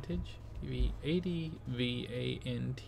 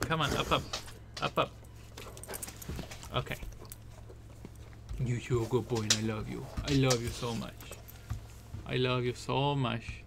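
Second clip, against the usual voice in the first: doting pet talk; 4 dB quieter, much faster than usual. Real, not acted. Cooing at the puppy.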